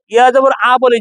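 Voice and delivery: usual speaking voice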